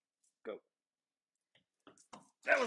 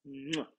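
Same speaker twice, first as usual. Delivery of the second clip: loud smacking kiss; kissing it better; 3 dB louder than usual. Real, not acted. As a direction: kiss the hurt away. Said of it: "Can we kiss it better?".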